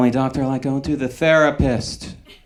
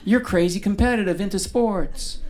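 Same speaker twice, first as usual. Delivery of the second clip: higher, mock-confident woman, lightly voiced; a know-it-all reading your star sign; knowing woman at a bar; 7 semitones higher than usual. A woman at the bar telling him his sign, a hint of smug.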